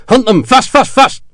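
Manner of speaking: loud, high rallying call; called order. A narrator's reading. Officer sending his sepoys after the garrison, loud call. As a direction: urge the men on.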